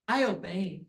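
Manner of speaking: usual speaking voice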